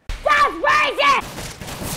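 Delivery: high, drawn-out outraged yell; outraged shout. Pretend. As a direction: shriek it slowly, very high. Mock outrage at friends' joke.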